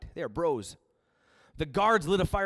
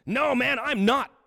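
loud denial; 9 semitones higher, 8 dB louder, slower than usual. Acted. Peter's second denial, voiced.

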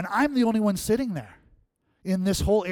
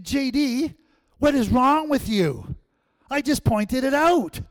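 high, loud and slow, fed up with the driver; half-shouted rebuke, high and loud; exasperated yelling; 8 semitones higher, 6 dB louder, slower than usual. Retold or imitated. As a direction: let him have it, slow and exasperated. Angry at driver missing exit.

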